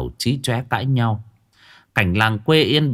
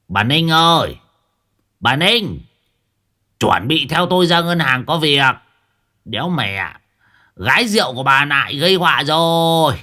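1 choked calling; 5 semitones higher, 4 dB louder than usual. A narrator's reading. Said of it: "nghẹn cổ".

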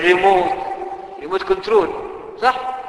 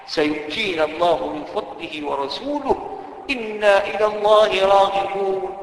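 slow verse recitation; 2 semitones lower, 4 dB quieter, slower than usual. Acted.

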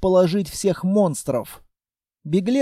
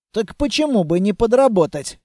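ordinary delivery, nothing marked

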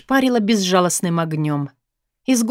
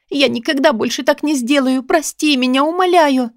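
high, desperate acted plea; tearful begging; 6 semitones higher than usual. A narrator's reading. Woman promising never to do it again, tearful begging. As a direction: promise and beg, climbing higher. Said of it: "закричала я, давясь рыданиями".

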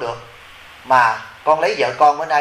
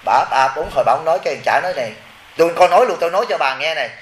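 ordinary talk, nothing marked

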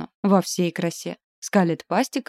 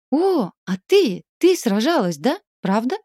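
excited; 6 semitones higher, 5 dB louder than usual. Acted. Eager wolf girl asks.

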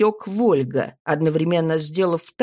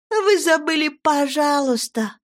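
mocking; 8 semitones higher than usual. A narrator's reading. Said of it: "насмешливо подсказала я".

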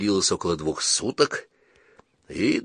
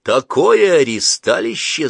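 slow, gloating and raised; gloating laugh; 6 semitones higher, 7 dB louder, slower than usual. Acted. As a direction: crow over him with glee. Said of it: "злорадно захохотал Калик". (злорадно, со смехом)

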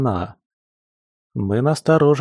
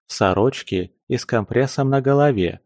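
plain reading voice

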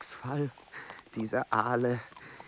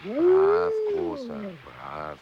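coaxing dog; 10 semitones higher, 4 dB louder, much slower than usual. Real, not acted. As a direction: coo to the dog to win it over.

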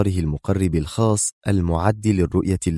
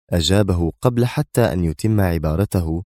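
ordinary delivery, nothing marked

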